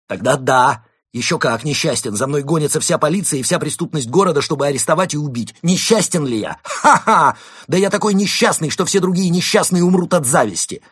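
loud, high rant with a mock laugh; agitated outburst. A narrator's reading. Константин proving his misery to fox, agitated rant. Hunted man rants about misery.

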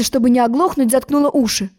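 usual speaking voice

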